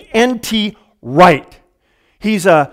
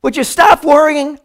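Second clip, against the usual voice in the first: mock nagging; 6 semitones higher than usual. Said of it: "somebody's just like".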